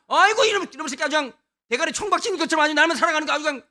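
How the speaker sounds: fast, loud, high Gyeongsang tirade; 경상도 사투리로 빠르게 몰아치는 욕설; exasperated Gyeongsang brother. Retold or imitated. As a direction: spit the scolding out quickly in Gyeongsang dialect. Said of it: "경상도 엘리압 한번 만나보시겠습니까".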